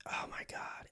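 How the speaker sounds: breathless whisper under the breath; a stunned little whisper; whispered "oh my god". Retold or imitated. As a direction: hush it out, barely voiced. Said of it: "I'm like".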